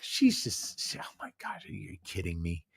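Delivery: breathless mock whisper; a hushed aside about her friend. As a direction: hiss it out under your breath. Mocking Tess's selfie pout.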